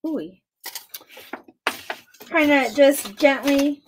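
ordinary delivery

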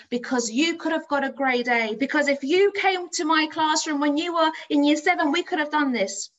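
building to high, loud insistence; exasperated passion. Retold or imitated. Teacher tells pupil he could have had an A, passionate and exasperated. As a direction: let the frustrated belief in him burst out.

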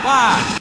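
high loud startled wah; startled wah yelp. Real, not acted. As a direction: yelp in fright. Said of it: "making me go, "Wah!".

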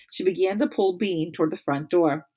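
usual speaking voice

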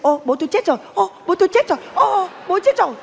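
high-pitched gleeful imitation; hammed-up joyful exclaiming; mock delight. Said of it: "Mắt mày cứ sáng rực".

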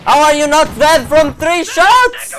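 slow, high, full-volume scream; furious yell. Real, not acted. Gamer whose enemy survived three shots, furious yell. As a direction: yell each word in disbelief.

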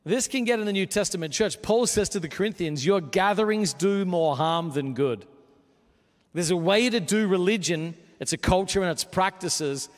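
impassioned sermon climax; full-force preaching. Acted. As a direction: preach it hard to the whole room.

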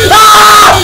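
high, full-volume startled scream; real piercing scream of fright; loud scream. Real, not acted. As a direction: scream at a sudden jump scare. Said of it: "loudest scream during this gameplay".

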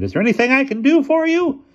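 high, loud, sharp and irritable; sharpened voice. A narrator's reading. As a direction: snap it out impatiently. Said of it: "Then his voice sharpened".

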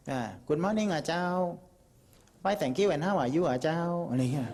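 slow English with a Northern Thai lilt and เจ้า; Northern Thai accent. Shown as a demonstration. Northerner greeting a foreigner in English, slow Northern Thai accent. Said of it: "คนเหนือพูดภาษาอังกฤษ".

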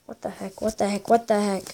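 ordinary talk

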